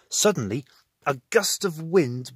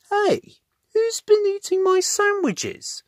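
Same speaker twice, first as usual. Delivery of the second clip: much higher, louder outraged cry; 6 dB louder than usual. A narrator's reading. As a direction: demand to know who ate it. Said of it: "he cried".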